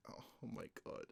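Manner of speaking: hushed, voiceless astonishment; awed whisper. Real, not acted. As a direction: whisper it, overwhelmed. Overwhelmed reaction under the breath.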